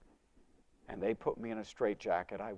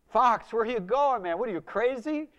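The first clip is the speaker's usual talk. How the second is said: high, loud call after him; incredulous calling; 11 semitones higher, 10 dB louder than usual. Retold or imitated. Friends call after him as he leaves the party, loud high calling. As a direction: yell after someone leaving.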